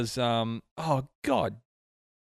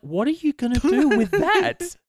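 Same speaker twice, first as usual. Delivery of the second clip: high, loud disbelief; incredulous; 12 semitones higher, 5 dB louder than usual. Retold or imitated. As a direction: scoff at it in disbelief. Mocking the tiny budget.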